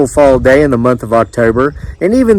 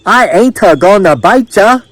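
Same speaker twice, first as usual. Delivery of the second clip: put-on raised-pitch, clumsy southern accent; failed southern accent; 7 semitones higher, 3 dB louder than usual. A narrator's reading. Island woman reassuring the crowd, failed southern accent. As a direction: attempt southern speech, missing the sounds. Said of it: "trying her best to say y'all in a southern accent and failing miserably".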